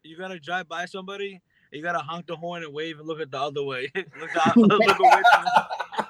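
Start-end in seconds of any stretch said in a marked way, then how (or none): 4.6-6.1 louder and slow through laughter; words broken by laughs; laughing. Real, not acted. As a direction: speak while cracking up.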